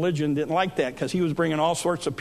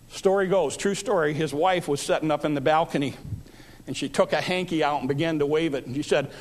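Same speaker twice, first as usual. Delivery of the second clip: ordinary delivery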